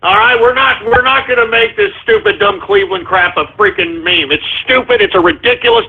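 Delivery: usual speaking voice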